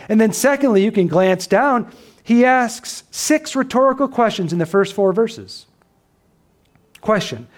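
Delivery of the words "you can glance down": usual speaking voice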